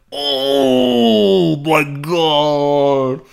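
very slow, loud, drawn-out horrified "oh my god"; long wailing "oh my god" in shock; stunned gasp. Retold or imitated. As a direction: crowd reacting to a bad crash. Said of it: "everybody was like".

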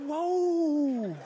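long, soft, high whoa; taken aback. Retold or imitated. Startled passenger reacting to the mother, softly stunned. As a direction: draw it out quietly, falling away.

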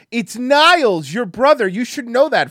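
high, loud, stretched-out punchline; mock-exasperated shout. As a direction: yell it as an indignant reminder. Retold exasperated punchline of the prank.

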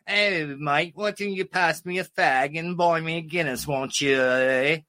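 mock English pub-goer; English accent. Comic imitating English folks at his show, mock English accent. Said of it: "the English comedy with the English accent".

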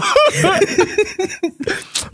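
high, helpless laugh; cracking up. Real, not acted. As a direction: burst into a long, high laugh. Reader cracks up.

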